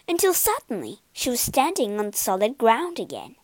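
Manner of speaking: ordinary delivery, nothing marked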